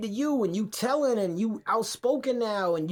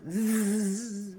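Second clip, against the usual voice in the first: fly buzz. Retold or imitated.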